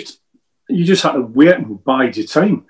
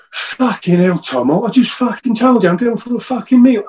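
mock aggressive mate; 6 semitones higher than usual. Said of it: "And he speaks like that".

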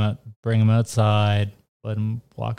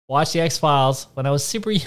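usual speaking voice

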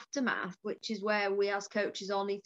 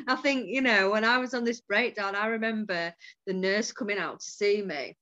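normal talking voice

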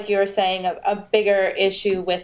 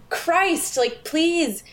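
higher-pitched exasperated plea; fed up, pitched up and pleading; 8 semitones higher, much slower than usual. Retold or imitated. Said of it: "it's just like".

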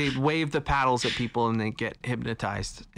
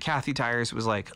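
normal talking voice

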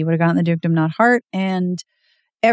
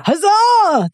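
much higher, louder triumphant cheer; 12 semitones higher, 4 dB louder than usual. Real, not acted. Celebrating that the heroine wakes up, with a loud high cheer. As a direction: throw it up like a victory cry.

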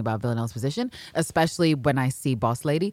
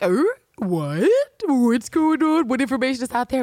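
mock clueless; 7 semitones higher, 4 dB louder than usual. Host mimics Villanelle and Eve knowing nothing, mock clueless. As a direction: play dumb, stretching it out up high. Voices the two clueless women.

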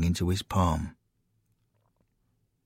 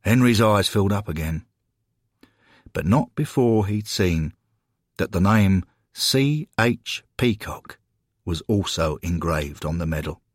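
ordinary delivery, nothing marked

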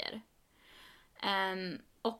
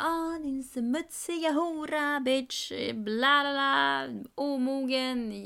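slow, higher-pitched imitation of ranting; mock insult tirade; 4 semitones higher, slower than usual. Friend insulting her after being refused housing, mock tirade. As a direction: reel off insults as a mocking impression.

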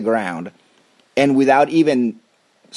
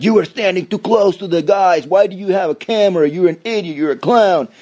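high, louder mock-indignant scolding; 8 semitones higher, 3 dB louder than usual. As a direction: sneer like a self-righteous commenter. YouTube commenters voiced scolding cameraman.

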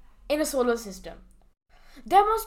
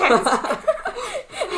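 burst of laughter; 8 semitones higher, 4 dB louder than usual. Real, not acted. Laughs at own joke.

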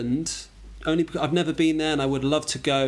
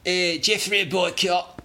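slow, higher-pitched Yorkshire accent; 6 semitones higher, slower than usual. Shown as a demonstration. Guest impersonating his Yorkshire wife, in a Yorkshire accent. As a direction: imitate a broad Yorkshire accent. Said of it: "It's a Yorkshire".

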